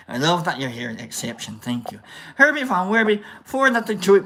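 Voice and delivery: ordinary delivery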